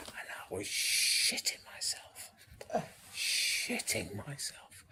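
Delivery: hushed confession, breath only; whispering. Retold or imitated. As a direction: whisper it like a nervous secret. Nervous before first big gig.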